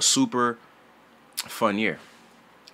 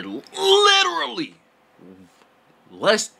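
high squeal; 12 semitones higher, 5 dB louder, slower than usual. Real, not acted. Sudden shriek mid-sentence.

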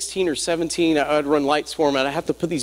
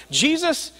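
raised, fervent preacher's delivery; preaching; 9 semitones higher than usual. Acted. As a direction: preach it higher, building to the congregation.

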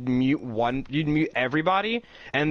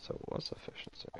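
whisper; 17 dB quieter, faster than usual. Real, not acted. Whispered realization.

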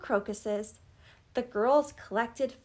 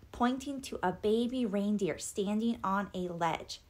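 usual speaking voice

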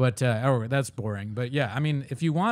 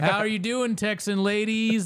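mock comedian greeting; 7 semitones higher than usual. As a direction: croon at the Irish crowd like a lounge act. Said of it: "That'd be funny if he said that in Ireland".

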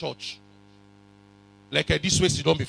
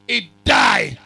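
shout; 4 semitones higher, 9 dB louder than usual. Acted.

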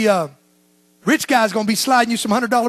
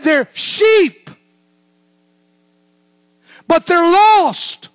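louder, higher, slowing hard on lost; booming proclamation; 6 semitones higher, 6 dB louder, much slower than usual. Acted. Preacher quoting Jesus to the congregation, booming. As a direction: preach it out to the whole room.

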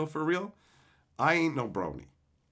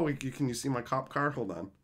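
ordinary talk

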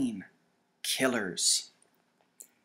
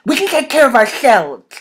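loud, raised, gruff insistence; irritated, emphatic retort; growled; 9 semitones higher, 14 dB louder than usual. A narrator's reading. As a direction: growl it forcefully in a character voice. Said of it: "he growled".

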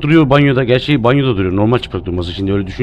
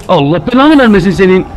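startled curse; 5 semitones higher, 5 dB louder than usual. Real, not acted. Cursing at a game jumpscare.

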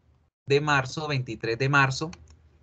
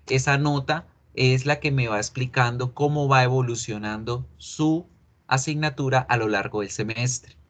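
ordinary delivery

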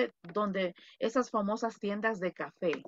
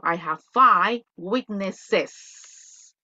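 "weaknesses" stressed, very slowly and louder; teacher demonstrating the plural slowly; plural model; 5 dB louder, much slower than usual. Shown as a demonstration. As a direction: stretch out the plural ending slowly. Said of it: "un ejemplo".

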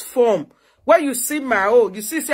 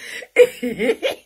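mocking laugh. Real, not acted.

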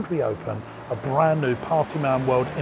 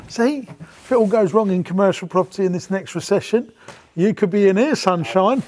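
bingo caller; 6 semitones higher, 4 dB louder than usual. Pretend. Joking he could be a bingo caller.

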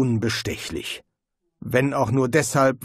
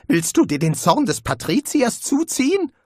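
panicked; 10 semitones higher, 3 dB louder than usual. A narrator's reading. Rincewind panics, follows whisper.

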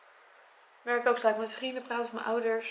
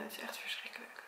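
whispered; 11 dB quieter than usual. Real, not acted. Heartbroken girl repeats it to her camera, whispering. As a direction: whisper "Het is echt verschrikkelijk" on breath alone, no voice.

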